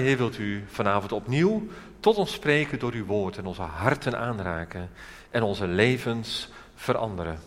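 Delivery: soft, earnest spoken prayer. Real, not acted. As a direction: lead the congregation in a subdued, pleading prayer. Leads a congregation in prayer. (ingetogen gebed)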